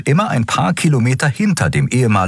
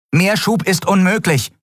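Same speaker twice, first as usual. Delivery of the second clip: high, lightly voiced engineer, "Schub" falling; engineer's reply; 13 semitones higher than usual. A narrator's reading. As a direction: report the limit to the captain. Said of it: "antwortete die Ingenieurin".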